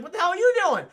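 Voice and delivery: high, loud acted disbelief; mock incredulous yell. Will Smith to his partner, mock incredulous yell. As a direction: yell it as a baffled partner would.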